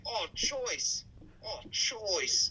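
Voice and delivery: put-on light Kiwi accent on choice; mock Kiwi saying Oh, choice; imitating Kiwi slang. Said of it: "they always say".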